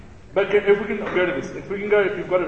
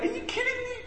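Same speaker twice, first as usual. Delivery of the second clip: much higher, squeaky outraged cry; indignant disbelief; 7 dB quieter than usual. Retold or imitated. Pretending to be Hezekiah hearing he will die, squeaky outrage. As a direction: shriek it in mock disbelief. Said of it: "I would be like".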